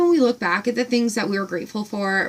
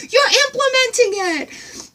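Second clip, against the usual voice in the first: much higher, amazed; thrilled squeal; 13 semitones higher than usual. Retold or imitated. Retelling her delight to the farm woman, squealing high. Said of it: "I'm like".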